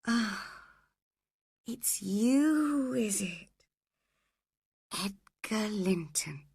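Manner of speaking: faint, slow, whispery drift; barely recognize him, trailing into a whisper; weak, half-conscious. A narrator's reading. Almost unconscious wife slowly recognizes husband.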